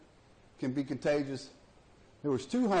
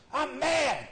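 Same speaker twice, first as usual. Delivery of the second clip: mock anger; 11 semitones higher, 4 dB louder than usual. Hunter acting angry in joke.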